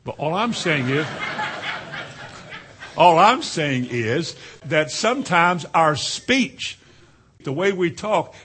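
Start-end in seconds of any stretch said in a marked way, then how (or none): 1.1-2.9 quiet, voiceless chuckle; laugh. Real, not acted. Preacher amused by his slang example, quiet laugh. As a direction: chuckle under the breath.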